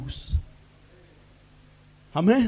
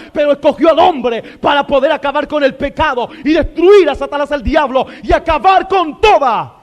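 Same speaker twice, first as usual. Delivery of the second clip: driving, raised-pitch sermon delivery; fervent preaching; 4 semitones higher, 4 dB louder than usual. Real, not acted. Preacher telling the congregation God chose man, fervent preaching. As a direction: preach it in long, forceful, surging phrases.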